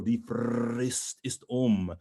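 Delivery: "Frist" with a long trilled R; long rolled R. Shown as a demonstration. Coach quotes Dutchman's entrance, long rolled R in "Frist". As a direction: roll the R of "Frist" hard and slowly. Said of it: "Give me the best R".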